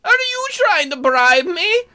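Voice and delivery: high, loud, drawn-out challenge; indignant accusation. Acted. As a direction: stretch it out in affronted disbelief. Character challenges an offered bribe.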